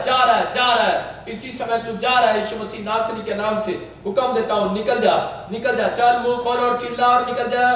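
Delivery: forceful, insistent repeated commands; exorcism preaching. Real, not acted. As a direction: hammer each phrase at the spirit. Commands the spirit out. (दुष्ट आत्मा को ज़ोर से आदेश)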